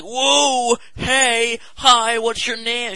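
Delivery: high, loud, gushing street fundraiser; overexcited greeting. Retold or imitated. Charity woman hailing a teen in the street, gushing and loud. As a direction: burst out at a passer-by with fake delight.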